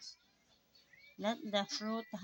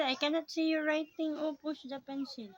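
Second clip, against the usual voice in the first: urging, raised voice; 5 semitones higher, 5 dB louder than usual. Real, not acted. Mother urging child to press.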